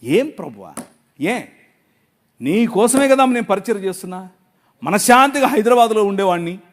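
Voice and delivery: ordinary delivery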